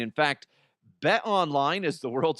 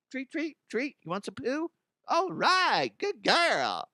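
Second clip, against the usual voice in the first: talking to the dog; 10 semitones higher than usual. Retold or imitated. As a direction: coax the dog sweetly. Voicing himself talking to dog.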